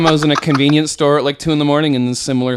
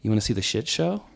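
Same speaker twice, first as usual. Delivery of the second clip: softer, understated offer; very calm; 2 semitones lower, 7 dB quieter than usual. Retold or imitated. Drunk friend quietly inviting a stranger, very calm. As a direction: ask it calmly and quietly. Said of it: "to me, very calm".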